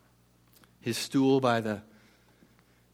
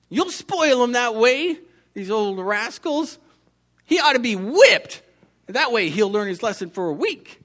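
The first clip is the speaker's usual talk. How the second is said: put-on indignant old woman; 8 semitones higher, 5 dB louder than usual. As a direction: insist he deserves a whipping. Old woman objects harshly.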